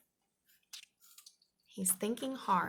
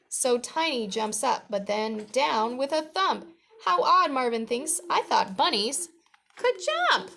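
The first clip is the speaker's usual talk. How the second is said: put-on lively, projected read-aloud for children; storybook reading; 4 semitones higher, 9 dB louder than usual. A narrator's reading. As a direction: read it out clearly to young listeners.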